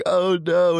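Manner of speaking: fake-scream it at the caller; mock scream. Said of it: "as I'm screaming".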